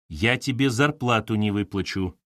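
plain reading voice

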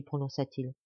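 ordinary delivery, nothing marked

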